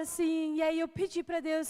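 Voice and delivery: ordinary talk, nothing marked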